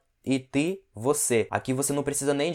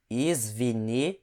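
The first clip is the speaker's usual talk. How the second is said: very slow, word by word; slow repeat; 2 semitones lower, much slower than usual. Shown as a demonstration. Teacher models the apology phrase for learners, very slow. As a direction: spell the phrase out slowly in sound. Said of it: "Mais uma vez".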